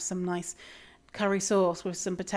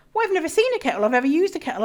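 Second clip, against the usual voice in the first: imitating a puzzled local; 7 semitones higher, 4 dB louder, much faster than usual. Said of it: "it's kind of like".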